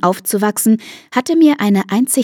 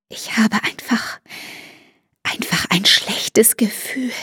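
slow, uneasy whisper; 3 semitones higher, 5 dB quieter than usual. Acted. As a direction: whisper it into the phone. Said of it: "flüsterte sie ins Telefon".

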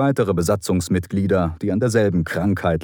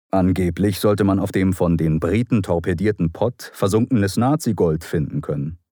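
usual speaking voice

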